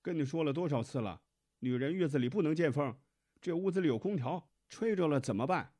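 high, loud, fast nagging; scolding mother-in-law. A narrator's reading. Mother demands thick pyjamas for daughter-in-law, scolding loudly.

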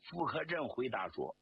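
usual speaking voice

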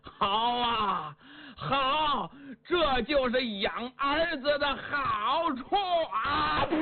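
high, tearful and slow; bitter crying. A narrator's reading. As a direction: choke out the bitter words. Father before suicide, bitter.

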